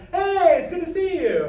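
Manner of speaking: high, loud friendly call; sustained greeting called down a hallway. Shown as a demonstration. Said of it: "Call with your voice".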